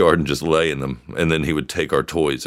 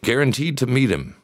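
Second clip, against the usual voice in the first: car ad voice; 8 semitones higher than usual. Pretend. Mock car advert pitch.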